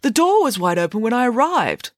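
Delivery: high, mildly indignant explanation; a touch of shocked protest. A narrator's reading. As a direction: plead innocence with lifted pitch. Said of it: "shocked at the suggestion".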